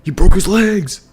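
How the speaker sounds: high, loud hammed-up panic; mock horrified cry; alarmed bystander. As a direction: wail about the broken legs.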